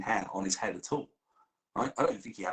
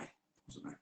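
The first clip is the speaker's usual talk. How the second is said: fading protest; 15 dB quieter, faster than usual. Pretend.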